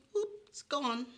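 high, quick "whoop"; playful whoop. Acted. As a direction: act surprised it vanished. Playful disappearing umbrella.

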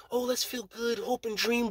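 high, soft, airy mockery; Obama-era optimism mocked in a high, soft voice; mocking dreamy voice. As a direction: sneer at the feel-good slogan softly and high. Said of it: "all that bullshit".

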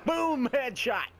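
a gamer's triumphant yell; excited cheer. Real, not acted. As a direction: crow over the headshot. Celebrating a kill.

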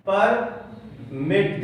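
Permit drawn out as two separate beats; slow teaching split of permit; two-syllable demo. Shown as a demonstration. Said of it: "दो बार".

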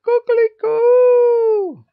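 very high, loud, drawn-out crowing; rooster crow. A narrator's reading. Rooster crowing at the boy, loud high crow. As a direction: crow like a rooster, stretching the last note. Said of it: "crowed the rooster".

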